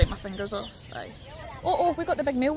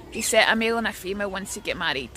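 ordinary delivery

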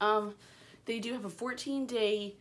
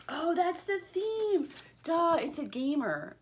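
surprised realization; 8 semitones higher, slower than usual. Real, not acted. Realises the box theme.

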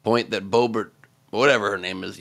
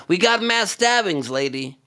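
mild mock yell, higher; retold shout; 7 semitones higher than usual. Comedian retells the heckler's line, mild mock shout. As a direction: fling it out like a heckle. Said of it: "shouts out".

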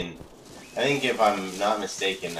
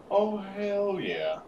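excited; 13 semitones higher, much slower than usual. Real, not acted. Stretched-out delighted exclamation.

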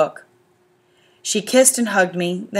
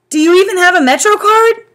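much higher, louder worried call; calling after; 12 semitones higher, 8 dB louder than usual. A narrator's reading. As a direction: call after her as she leaves. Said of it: "Mom said after me".

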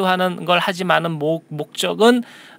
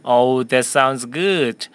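slow, clear sentence for repeating; teacher modelling a question slowly for students; slow model reading; much slower than usual. Shown as a demonstration. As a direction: read it slowly for the class to echo. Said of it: "한 번 더요".

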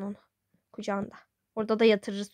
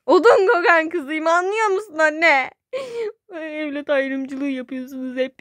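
mock crying; 5 semitones higher, 5 dB louder, slower than usual. Daughter complains of unfair treatment.

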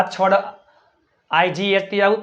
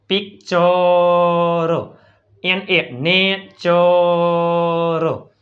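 slow model reading; much slower than usual. Shown as a demonstration. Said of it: "slow model reading".

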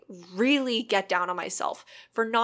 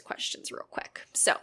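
whispering; 3 semitones lower than usual. Shown as a demonstration. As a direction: hush it like ASMR. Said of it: "move over to A S, Zumi A S M R".

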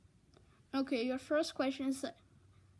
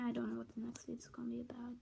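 whispering; 10 dB quieter, much faster than usual. Real, not acted. Whispered aside.

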